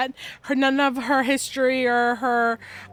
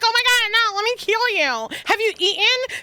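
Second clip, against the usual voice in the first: fussing mother hen; 9 semitones higher, faster than usual. Acted. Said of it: "she's like".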